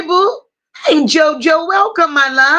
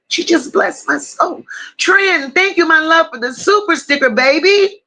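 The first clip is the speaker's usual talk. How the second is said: ordinary delivery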